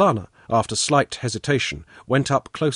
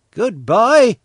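loud, raised-pitch friendly goodbye; smiling watchman's farewell; 11 semitones higher, 9 dB louder than usual. A narrator's reading. Watchman seeing the travellers off, loud and friendly. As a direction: call goodbye with a smile. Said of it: "with smiling eyes".

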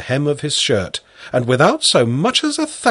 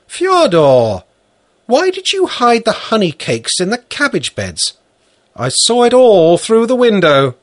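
scolding woman; 11 semitones higher, 6 dB louder than usual. Acted. Valentina scolds the boy.

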